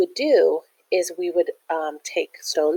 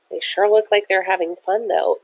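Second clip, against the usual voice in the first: ordinary delivery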